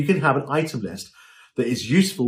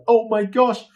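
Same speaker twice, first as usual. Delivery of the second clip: mock dismay; 8 semitones higher, 3 dB louder, slower than usual. Overwhelmed browsing dress rail.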